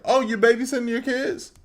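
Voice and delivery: high, loud mock surprise; mocking nosy person. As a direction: imitate a nosy busybody. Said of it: "say to a mom, like".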